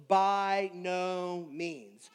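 very slow, each word weighted; preacher quoting Romans, slow emphatic no; emphatic refusal. Retold or imitated. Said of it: "a very emphatic way of saying no".

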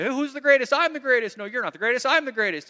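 loud, high bickering shout, disciples outdoing each other; squabbling yell. Retold or imitated. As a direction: yell it like squabbling boys claiming the top spot. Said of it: "they're all yelling at each other".

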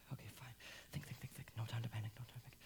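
breathless whisper to himself; frightened whisper. Acted. Cornered father whispering to himself to keep calm. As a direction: hush his own panic.

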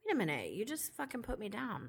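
soft mock-offended aside; muttered realization. Reacting inwardly to the judge's put-down, muttered. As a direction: say it under your breath, retelling.

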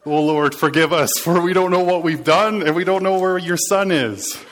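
loud, pleading mock prayer with a laugh breaking through; hammed-up cry of a frightened people to God; panicked crowd prayer. All of Israel begs the Lord, hammed-up panicked plea.

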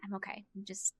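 softly, worn out and quiet; tired. Real, not acted. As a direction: let the voice sag, real fatigue. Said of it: "just a little tired".

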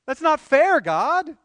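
a loud, high protest, lightly acted; mock complaint. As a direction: whine it at God like a wronged child. Voicing people complaining to God.

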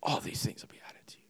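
soft, fast whispered aside. Real, not acted. Preacher whispers the promise to the congregation, soft and fast. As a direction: breathe it out like a secret.

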